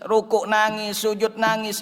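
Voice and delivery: ordinary delivery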